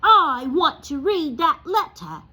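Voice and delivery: high, loud and slow whine; spoiled demand, drawn out. Acted. Dudley demanding the letter from his father, loud and whiny. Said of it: "he said loudly".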